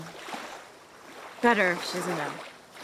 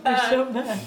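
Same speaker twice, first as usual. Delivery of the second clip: chewing sticky toffee; 2 semitones higher than usual. Real, not acted. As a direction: mouth glued with pancake. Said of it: "I can't open my mouth".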